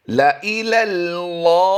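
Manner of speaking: ला इलल्लाही chanted slowly and high; Quranic recitation. Shown as a demonstration. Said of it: "इस वक्त आप अपनी screen पर".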